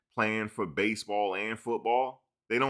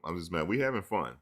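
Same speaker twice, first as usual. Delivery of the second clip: ordinary delivery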